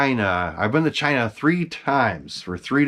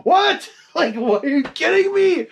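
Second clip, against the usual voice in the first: much higher, incredulous what, laughing through it; retold shocked disbelief; 3 dB louder than usual. Collector retelling hearing Frank kept the mask, shocked disbelief. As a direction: replay your outrage as a comic high-pitched squawk.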